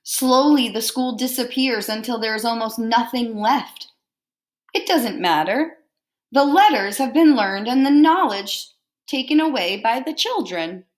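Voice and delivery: lively picture-book read-aloud; reading to children. Acted. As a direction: read it out warmly to a class.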